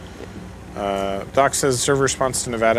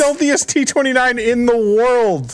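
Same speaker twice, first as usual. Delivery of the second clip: much higher and louder, slowing down; incredulous, almost shrieking; shocked disbelief; 5 dB louder than usual. Real, not acted. Shocked by a hidden enemy.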